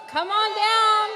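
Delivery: shouted high and bright; game show call. Real, not acted. Calling winner to the stage.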